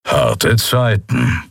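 slow, dry grumble; bitter sarcasm. A narrator's reading. Kero comments sourly on the tiny ship, with bitter sarcasm. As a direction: toss off a sour joke. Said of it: "brummte Kero mit galligem Sarkasmus".

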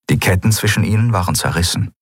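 plain reading voice

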